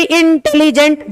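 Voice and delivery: Intelligent in separate pieces, the first one stretched; syllable jolts. Shown as a demonstration. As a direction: break intelligent into jolts, "इन... tele... gent". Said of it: "झटके लगाए हमारी जबान". (Intelligent को झटकों में तोड़कर बोलें)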